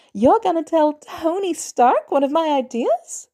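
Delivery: put-on a thrilled boy gushing over a gift; excited kid. Boy thanking Natalia for the jacket, thrilled. Peter amazed.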